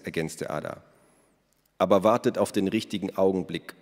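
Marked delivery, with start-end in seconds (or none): none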